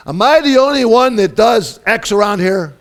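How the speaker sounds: loud, high, fed-up whine of the overworked; exasperated complaint. Retold or imitated. Preacher voicing a spouse's exasperated complaint. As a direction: complain that nobody else helps. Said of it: "have you ever said this?".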